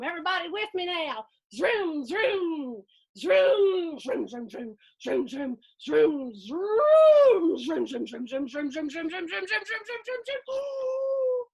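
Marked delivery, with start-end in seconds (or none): 1.5-11.4 zoom faster and climb in pitch; engine zooming. Acted. Boy playing race car through the dust, revving engine noises. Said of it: "making his mouth make sounds".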